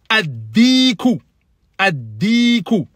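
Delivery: Ad-diku said slowly with a doubled d; slow model pronunciation. Shown as a demonstration. Teacher slowly models Ad-diku for students. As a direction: stretch out Ad-diku, the d held. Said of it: "Comment on dit?".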